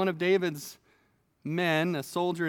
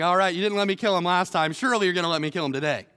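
fast, louder mock impatience; eager soldier; 4 dB louder, faster than usual. Abishai asks David to let him kill Shimei, fast and eager. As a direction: rattle it off, itching to act. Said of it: "he's like".

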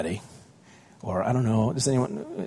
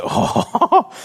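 high, loud laughing oh; stunned little oh, half laughing; taken aback; 6 dB louder than usual. Retold or imitated. Father stunned by his daughter's look, laughing oh. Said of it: "I was just like".

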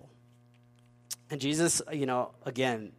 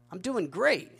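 mock-proud, higher voice; smug boast; 4 semitones higher than usual. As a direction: brag like a smug retiree. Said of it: "We have economic pride".

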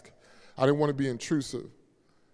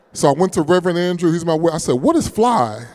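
louder, driving retelling; loud preaching story; 8 dB louder than usual. Acted. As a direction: tell it big to the room.

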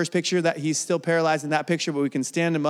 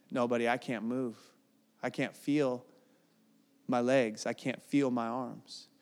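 much softer, lower, barely there; faint and stricken; 5 semitones lower, 10 dB quieter than usual. Retold or imitated. Injured friend on the beach telling his buddy he can't move, hushed and lower. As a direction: speak like you've just realised you can't move.

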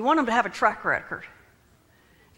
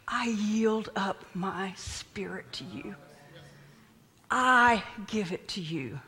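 slow, soft, solemn handing over; hushed surrender; 2 semitones lower, 5 dB quieter, slower than usual. Acted. Preacher voicing Jesus to his Father, hushed surrender. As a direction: give it up slowly and quietly.

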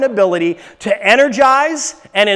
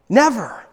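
"Never" set apart as one deliberate word; emphatic NEVER. Shown as a demonstration. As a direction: let "Never" stand alone, falling at the end. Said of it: "put some more emphasis on it".

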